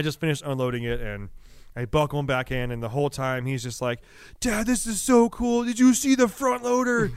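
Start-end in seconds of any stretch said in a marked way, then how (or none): 4.4-7.1 put-on high, slow, eager little-kid voice; excited toddler. Son tells dad about the front loader, high and wide-eyed. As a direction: gush like a thrilled small boy.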